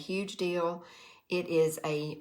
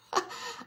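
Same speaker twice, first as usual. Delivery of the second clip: short breathy high giggle; 4 dB quieter than usual. Real, not acted. Laugh after gunny sack.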